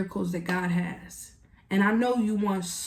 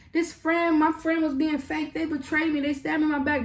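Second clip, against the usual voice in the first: mock wounded complaint; 7 semitones higher than usual. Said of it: "They call you".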